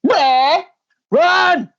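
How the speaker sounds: high loud urgent shout; shouting run. Acted. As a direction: scream at them to flee. Fleeing the hunters.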